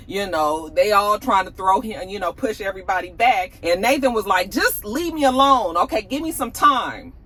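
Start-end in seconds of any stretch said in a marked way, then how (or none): 4.5-7.1 mock an angry man pushing people away; pissed off. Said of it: "he was pissed".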